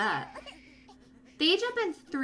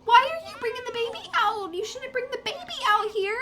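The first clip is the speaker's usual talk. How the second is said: alarmed scolding; 11 semitones higher, 3 dB louder, faster than usual. Real, not acted. Scolding Sim bringing baby.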